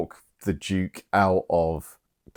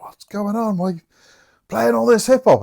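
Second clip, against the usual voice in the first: higher, put-out uptight voice; complaining neighbour; 14 semitones higher than usual. Retold or imitated. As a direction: tell the partiers off, affronted.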